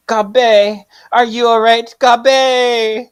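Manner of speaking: hammed-up concerned friend; worried call. Worried call in comic scene.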